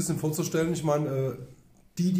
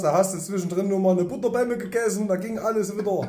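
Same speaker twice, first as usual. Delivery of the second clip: excited; 7 semitones higher, 5 dB louder than usual. Real, not acted. Excited memories of carnival.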